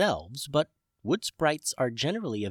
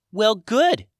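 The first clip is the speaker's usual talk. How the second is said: high, bright fairy voice; cheery sprite voice; 11 semitones higher, 9 dB louder than usual. A narrator's reading. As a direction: chirp it like a tiny friendly sprite. Rachel voiced.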